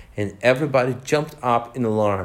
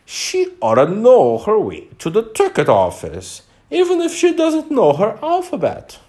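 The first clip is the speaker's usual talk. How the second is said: goat's voice, louder and much higher at the end; 10 semitones higher, 6 dB louder than usual. A narrator's reading. Goat in the carriage loudly telling Alice off. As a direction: call it out loud like a bleating goat. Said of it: "said in a loud voice".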